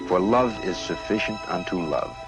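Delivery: usual speaking voice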